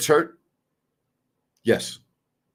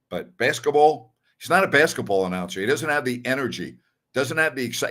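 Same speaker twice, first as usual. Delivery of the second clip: usual speaking voice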